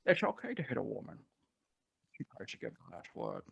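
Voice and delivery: soft, dropping Scottish impression with slushy s; Sean Connery. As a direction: imitate a Scottish film star, quiet and deliberate. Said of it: "Sean Connery".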